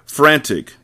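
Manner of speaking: plain reading voice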